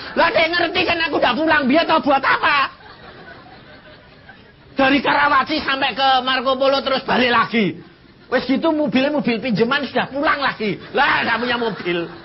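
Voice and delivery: high, loud, laughing through it; laughing preaching. Real, not acted. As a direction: laugh at his own mistake.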